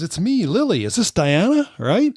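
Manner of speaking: high-pitched put-on texting voice; Lily. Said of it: "She goes".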